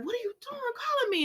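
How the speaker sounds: very high, quick hushed squeal; hammed-up panicky question, pitch way up; mock alarm. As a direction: ask it high and hurried, imitating alarm. Sheila panics at Deacon's call.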